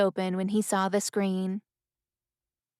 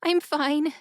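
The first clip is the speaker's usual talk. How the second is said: high, tearful woman's voice; acted tearfulness, pitched well up; between sobs; 7 semitones higher than usual. A narrator's reading. Crying woman reassuring a worried man, between sobs. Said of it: "she said quickly between sobs".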